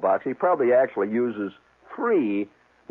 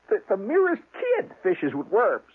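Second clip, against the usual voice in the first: indignant; 8 semitones higher than usual. Retold or imitated. Continues indignant protest.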